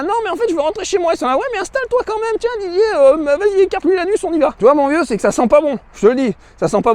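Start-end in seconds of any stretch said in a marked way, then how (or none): 0.0-1.1 high, fast, pleading voice; hammed-up high plea to go home; whiny protest. You protest in the cellar. 1.4-4.5 high, put-on voice of the cellar guys; mock creepy hosts.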